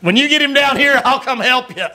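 high, eager cheer from the sidelines; cheering him on. Retold or imitated. Preacher cheering his friend into the ring, eager. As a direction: egg him on like a fan at ringside. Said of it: "I was like".

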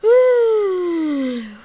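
long, loud falling groan of a sigh; loud frustrated sigh. Real, not acted. Frustrated after losing in game.

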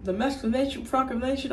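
fast high retold question. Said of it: "He's like".

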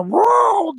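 very high, loud mock roar; bear roar. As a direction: burst out from behind the tree roaring. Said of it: "diye bir çıkıyorum". (ağacın arkasından yüksek sesle kükreyerek)

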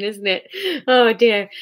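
ordinary delivery

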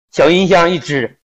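ordinary talk, nothing marked

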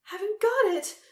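high, loud whimper. A narrator's reading. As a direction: whimper it like a cornered old empress. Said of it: "whimpered the Empress".

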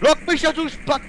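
loud, high burst of irritation; irritated shout; angry yell. Real, not acted. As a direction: yell at the teammate in your way. Yelling at blocking teammate.

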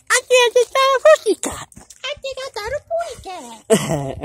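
high cartoon bird voice. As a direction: sing it out like a tiny cartoon bird. Cartoon bird line repeated higher.

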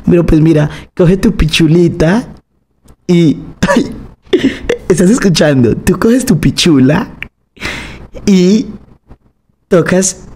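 put-on high, slow baby-talk to a little kid; talking to a child. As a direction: coo it out like explaining to a toddler. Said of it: "cómo más explicarle a un niño". (voz aguda y lenta de hablarle a un niño pequeño)

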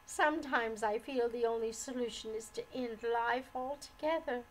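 plain reading voice

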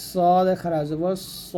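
"স" thick and rounded, held long; thick, heavy consonant. Shown as a demonstration. Teacher shows the heavy letter, thick drawn-out "স". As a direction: make "সদ" heavy, then stretch the final "স". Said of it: "আর এটা মোটা করে".

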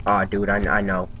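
usual speaking voice

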